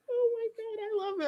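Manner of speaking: high squeal of adoration; real delighted squeal; squealing laugh. Real, not acted. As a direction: gush through a laugh. Overcome with affection.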